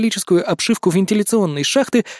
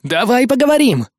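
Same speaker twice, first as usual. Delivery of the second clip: higher-pitched urgent plea; urgent appeal; 6 semitones higher, 3 dB louder than usual. A narrator's reading. Майло to the hostage-taker, urgent. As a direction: call out to negotiate.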